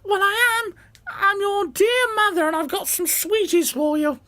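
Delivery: high sugary fake-mum voice; wolf pretending to be mother, sickly sweet; wolf faking sweet mother. Wolf tricking the kids, high put-on mother voice.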